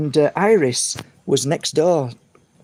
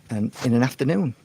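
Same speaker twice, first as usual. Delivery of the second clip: ordinary delivery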